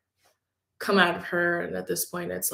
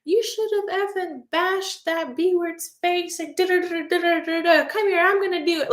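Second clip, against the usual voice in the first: much higher, raging scold; furious mom; 11 semitones higher than usual. Retold or imitated. Mother scolding her daughter for not fighting back, furious. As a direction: tear into your kid for not fighting.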